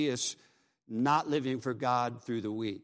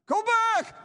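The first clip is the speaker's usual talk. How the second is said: final desperate shriek, much higher and louder; blood-curdling cry; 6 dB louder than usual. A narrator's reading. Dying teen's final cry to his friend, blood-curdling scream. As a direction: give it one last terrified scream. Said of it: "with a final cry, blood-curdling cry".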